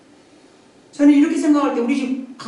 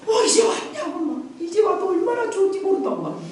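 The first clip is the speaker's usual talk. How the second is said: lightly voiced cheerful dog, higher; a delighted pet greeting; higher, gleeful welcome; happy dog; 8 semitones higher than usual.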